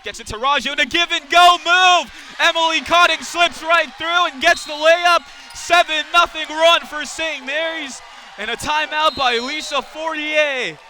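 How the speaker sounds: high, loud play-by-play climax; excited basket call. Real, not acted. Commentator calls Cotting's layup and the run, thrilled. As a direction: let the voice leap as the play scores.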